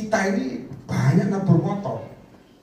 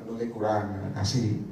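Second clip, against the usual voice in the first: softer and lower, humbly giving in; meek reply; 7 semitones lower, 5 dB quieter than usual. Retold or imitated. Preacher retells humbly agreeing with God, soft and low. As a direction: answer God quietly and obediently.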